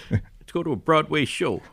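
high, loud Broadway-goer; brash New York tourist voice; New York accent. Pretend.

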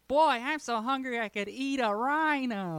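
loud, high-pitched mock child; kid voice. Hungry kid overheard in an Applebee's, loud high kid voice. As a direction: whine it out big like a cartoon kid. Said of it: "he hears a kid say".

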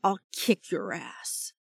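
slow, contained growl; growled threat. A narrator's reading. Shark drawing his katana on Ember, growled threat. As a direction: threaten slowly without raising your voice. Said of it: "he growled".

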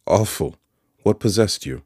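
shocked rebuke; dismayed Joe. A narrator's reading. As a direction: Joe scolds Pip in shock. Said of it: "cried Joe".

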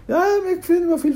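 very high mock-panicked cry; wailing mother. Mother crying out for her bitten child, hammed-up wail. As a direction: imitate a frantic mother, pitch way up. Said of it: "a mãe vai falar assim".

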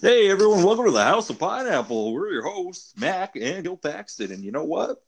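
high, bright show-host intro; announcer voice. Acted. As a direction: hype up the show opening.

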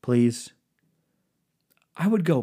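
ordinary delivery

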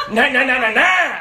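loud, laughing mock brush-off; hammed-up dismissive nah; marine brushing off. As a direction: wave him off with a laughing nah nah. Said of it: "All I remember is like".

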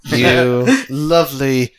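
mock sleazy voice. Mocks the sleazy greeting.

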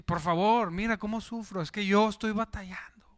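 soft whining, fading to a whisper; mock self-pity. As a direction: whine about suffering, softly. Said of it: "a veces caemos en eso". (queja lastimera susurrada)

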